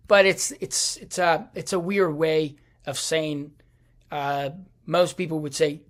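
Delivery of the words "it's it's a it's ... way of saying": ordinary talk, nothing marked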